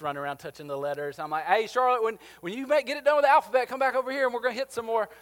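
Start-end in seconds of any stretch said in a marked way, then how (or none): 1.4-5.1 high, loud call across a noisy gym. Retold or imitated. Coach calls Charlotte back from the wall, loud and high. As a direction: shout it over running kids to a wandering girl.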